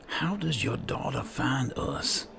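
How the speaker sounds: ordinary delivery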